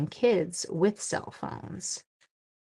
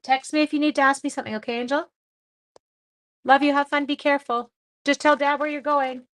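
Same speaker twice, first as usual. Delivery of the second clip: higher and louder, quick reminders called across the room; calling out to son; 8 semitones higher, 7 dB louder than usual. Real, not acted. Mother calling to her son going out, raised and quick. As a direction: call after him as he heads out the door.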